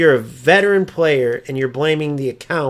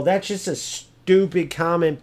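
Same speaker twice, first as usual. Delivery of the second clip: normal talking voice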